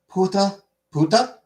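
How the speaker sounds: Puta, puta said high and punchy, syllable by syllable; sounding out syllables. Real, not acted. Player sounding out puttanesca piece by piece, high and choppy. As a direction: chop off the first part, puta, puta, up high.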